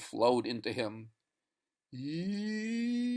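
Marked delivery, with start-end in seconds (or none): 1.9-3.1 high, soft, sung wail; soft eerie wail. Acted. Ancient winds singing, soft high wail. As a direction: sing like the old winds. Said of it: "sang the ancient winds".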